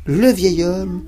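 ordinary delivery

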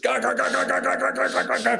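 high, rapid guttural rotor chop; machine-gun stutter of rotor noise; roaring helicopter imitation. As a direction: imitate deafening rotor blades overhead. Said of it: "the fucking loudest thing that's ever been near me".

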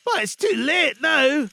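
high, loud character voice full of regret; regretful Scrooge. A narrator's reading. Scrooge realises it is too late, high regretful voice.

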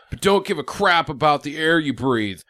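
ordinary talk, nothing marked

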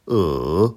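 E dipping low then climbing back up; third tone on E. Shown as a demonstration. Teacher showing learners Mandarin E, dipping tone. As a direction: draw E down and up again. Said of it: "Je les répète".

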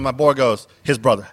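ordinary delivery

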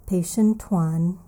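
usual speaking voice

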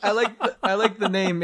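ordinary talk

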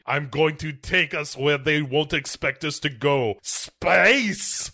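outrageous mock accent, high burst on the last word; Tim Curry. Host imitating a game cutscene, over-the-top accent. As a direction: ham up the villain's accent, launching the final word. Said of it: "he's using kind of this accent".

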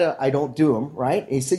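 usual speaking voice

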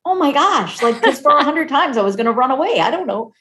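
high, loud, laughing oh my gosh; laughing disbelief. Real, not acted. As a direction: laugh it out in amazement. Laughing at her own near-escapes.

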